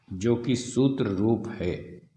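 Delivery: usual speaking voice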